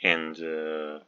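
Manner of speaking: ordinary talk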